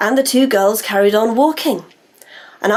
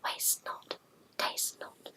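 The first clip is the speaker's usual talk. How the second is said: whisper; 23 dB quieter, slower than usual. Shown as a demonstration. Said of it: "Are you ready?".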